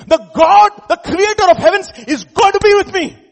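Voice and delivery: high, loud exultant preaching; full-force preaching. Real, not acted. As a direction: proclaim it loudly, building to a cry.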